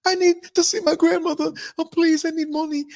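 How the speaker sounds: high pleading imitation of the stranger; begging woman. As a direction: beg like her, high and pleading. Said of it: "And she said".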